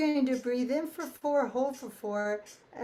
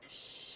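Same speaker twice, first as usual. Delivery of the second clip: sh hiss; 11 dB quieter than usual. Shown as a demonstration. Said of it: "doing a sh sound".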